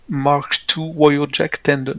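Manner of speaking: slow, English-accented reading of the name. Real, not acted. Game master names the loot for players, English accent. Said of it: "avec l'accent, j'ai pas tout compris".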